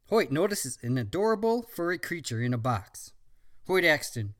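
usual speaking voice

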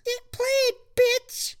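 gremlin voice. Acted. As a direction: sneer like a little gremlin. Mogwai voiced.

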